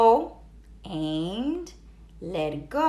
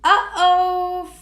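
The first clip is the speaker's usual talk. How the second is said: worried uh-oh; 6 semitones higher, 6 dB louder than usual. A narrator's reading. Narrator foreshadowing trouble.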